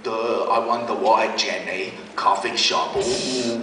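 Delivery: put-on drip with teenage sarcasm; teen mocking the coffee shop in his head; sarcastic mockery.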